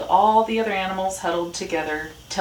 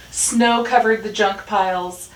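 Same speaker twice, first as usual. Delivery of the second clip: ordinary delivery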